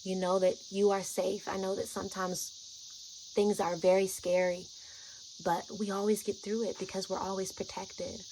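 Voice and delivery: calm a frightened friend softly; gentle soothing voice; very gently. Retold or imitated. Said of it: "talking to her very gently".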